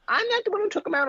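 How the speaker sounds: high, quick mom's protest; hammed-up defensive griping; complaining. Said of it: "complain, like".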